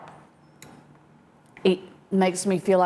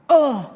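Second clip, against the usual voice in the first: pained whimper; 6 semitones higher, 7 dB louder than usual. Real, not acted. Mouth burning from hot sauce.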